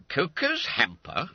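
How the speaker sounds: slow, puzzled echo; dazed repetition. Acted. Master baffled by the boy's confession, slow dazed echo. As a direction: repeat it as if in a dream. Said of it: "like a man in a dream".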